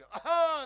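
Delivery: loud high throat clear; uncle clears throat. Retold or imitated. Uncle in the back seat clearing his throat, loud hack. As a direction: uncle hacks to open the window. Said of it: "એનો કાકો બેઠો હતો".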